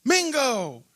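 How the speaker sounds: high, drawn-out cheer of the name; fan yell. Retold or imitated. The same trucker cheering his name at the game.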